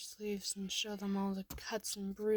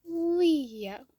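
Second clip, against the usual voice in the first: high, loud, drawn-out delight; happy; 7 semitones higher, 11 dB louder than usual. A narrator's reading. Little kid naming a favorite turtle, happily. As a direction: call it out happily, falling at the end. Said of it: "Then you say happily".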